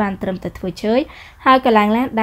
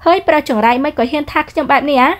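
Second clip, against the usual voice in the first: higher, sharp retort from a proud heroine; haughty young woman; 6 semitones higher, 4 dB louder than usual. Acted. Heroine snapping back at the rude man, higher pitched. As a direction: toss it back at him, offended.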